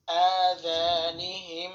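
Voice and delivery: আযানিহিম drawn out very slowly with humming মিম; nasal ghunna. Shown as a demonstration. Teacher modelling ghunna recitation for students. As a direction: stretch আযানিহিম and let the মিম hum. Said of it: "গুণনা করতে হবে".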